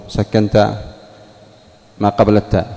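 ordinary talk

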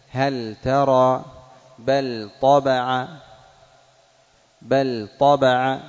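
هل ترى and بل طبع said slowly with the lam held distinct; slow clear lam. Shown as a demonstration. Teacher demonstrating the clear lam of هل and بل. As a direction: sound out each lam clearly before the next letter. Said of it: "لا بد من بيان اللام".

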